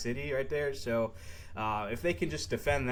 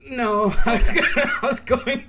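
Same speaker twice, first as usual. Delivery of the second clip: high laughing voice; 13 semitones higher, 15 dB louder, slower than usual. Real, not acted. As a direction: laugh through the words. Laughing off the accent request.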